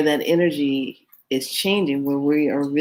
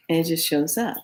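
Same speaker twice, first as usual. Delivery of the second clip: ordinary talk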